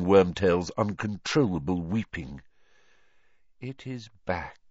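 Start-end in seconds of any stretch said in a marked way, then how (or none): 3.6-4.6 quiet cold voice; soft. A narrator's reading. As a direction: say it softly. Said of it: "he said softly".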